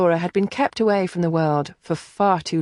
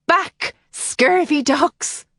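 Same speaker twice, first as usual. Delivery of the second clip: slow, high, growled pirate threat; pirate brogue; 9 semitones higher, much slower than usual. Acted. Twin playing pirate against the dogs, slow and high. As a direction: swagger like a stage pirate. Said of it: "cried in a piratical brogue".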